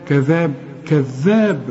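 كذاب with a stressed, doubled ذ; doubled dhal. Shown as a demonstration. Teacher drills the doubled letter for the student. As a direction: lean on the shadda in كذاب. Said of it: "مشددة ازاي".

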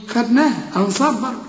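usual speaking voice

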